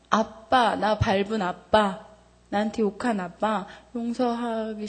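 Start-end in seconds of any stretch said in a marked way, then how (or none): none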